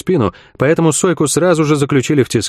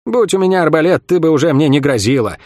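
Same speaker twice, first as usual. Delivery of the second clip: ordinary delivery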